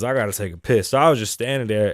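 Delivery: usual speaking voice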